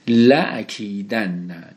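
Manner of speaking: لأکیدن read slowly, doubled n held; careful Arabic recitation. Shown as a demonstration. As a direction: stretch لأکیدن syllable by syllable. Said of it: "این لام هم باز لام حرف جر نیست".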